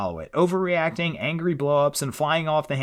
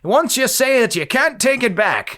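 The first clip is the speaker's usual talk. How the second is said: put-on cranky old man shaking his fist; high, loud old-timer rant; grumpy old man; 10 semitones higher, 7 dB louder than usual. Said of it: "this kind of reads like an old man".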